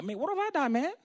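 high-pitched mock protest; protesting reply. David answering his angry brother, mock protest. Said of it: "he replies. He's like".

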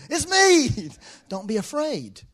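high, loud call that eases down. Retold or imitated. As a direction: hail them loudly, then reassure. Voices Jesus calling to terrified disciples.

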